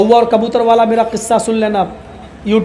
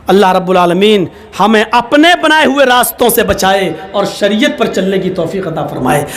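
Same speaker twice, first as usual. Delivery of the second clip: ordinary talk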